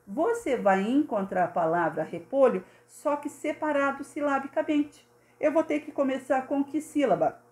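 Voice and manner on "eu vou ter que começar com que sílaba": normal talking voice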